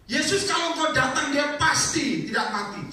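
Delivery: high, drawn-out pleading cry; Marta's plea. Retold or imitated. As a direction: plead slowly and loudly as Marta. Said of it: "Ngomongnya apa?".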